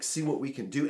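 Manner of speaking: plain reading voice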